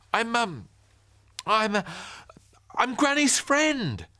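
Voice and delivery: put-on high, loud stammering boy; spluttering. Ben caught off guard by the neighbour, high spluttering. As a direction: splutter it out, slowly. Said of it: "spluttered Ben".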